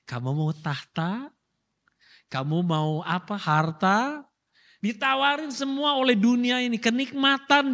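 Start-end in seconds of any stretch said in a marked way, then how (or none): 0.1-4.2 lightly voiced tempter, low with a rising lilt; a hint of a low-voiced tempter; tempting offer. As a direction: dangle the offer in a lowered voice.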